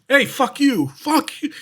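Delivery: high, loud mock-furious shout; pretend yelling. Charging at the ghost with a bat, pretend yelling. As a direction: yell it at a ghost, hammed up. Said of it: "bolting towards the room yelling".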